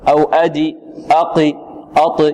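أدي and أقي ending in a short i vowel, the wrong way. Shown as a demonstration. Teacher showing the student error of adding a vowel. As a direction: let the bounce slide into a kasra. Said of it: "في الدال تقول أدي".

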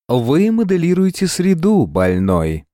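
high soprano announcer voice; train station announcer. A narrator's reading. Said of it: "превратился из щебетания в сопрано диктора железнодорожного вокзала".

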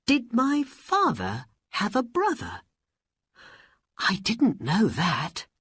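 put-on high boy's voice full of surprise; very surprised. A narrator's reading. Aladdin hearing his father had a brother, surprised. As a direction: ask in high-pitched amazement. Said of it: "He was very surprised".

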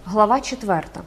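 ordinary delivery

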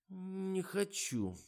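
high, slow tearful whine; whiny, drawn out. A narrator's reading. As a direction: whimper the refusal in a thin voice. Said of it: "плаксиво протянула Фатима".

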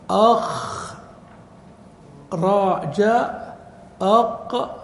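"أخرج", "أقوم" split into high, drawn-out syllables with pauses; syllable-by-syllable demonstration. Shown as a demonstration. Teacher showing the expected pattern, "أخرج، أقوم" in separated syllables. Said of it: "لكان القياس أن يقال".

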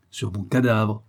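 usual speaking voice

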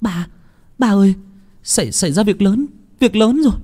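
high-pitched, stammering alarm; panicked daughter. Acted. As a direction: stumble over urgent news for your father. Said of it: "Nguyệt hốt hoảng". (giọng con gái hốt hoảng, lắp bắp)

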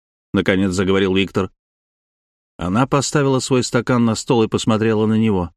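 plain reading voice

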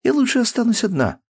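put-on high stubborn girl's voice; lightly acted defiance; defiant daughter. Daughter answering her father, defiant.

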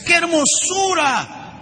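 high, slow cry of wonder; awed exclamation. Acted. Sculptor beholding a rough stone, rapturous high exclamation. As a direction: exclaim it grandly, pitch soaring and vowels stretched.

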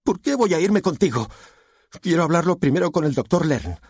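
high-pitched, upset objection; resistant daughter. A narrator's reading. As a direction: challenge him in a high voice.

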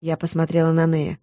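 ordinary delivery

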